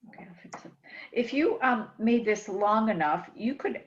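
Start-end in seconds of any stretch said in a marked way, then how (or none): 0.1-0.7 muttered whisper to herself. Real, not acted. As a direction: whisper it under your breath while concentrating. Muttering to herself while fixing.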